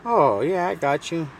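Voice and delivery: high, loud imitation of her; imitated woman. Woman agrees to pay him, imitated high and loud. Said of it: "And she's like".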